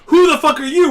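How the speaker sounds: high, loud hammed-up yell; mock monster shouting a challenge; mock angry shout. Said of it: "and he's like".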